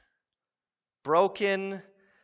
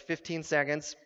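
usual speaking voice

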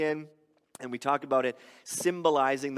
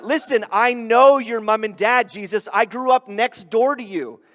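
loud, higher-pitched acted protest; indignant neighbour; 6 semitones higher, 8 dB louder than usual. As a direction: insist as someone who knows better. Acts neighbours confronting Jesus.

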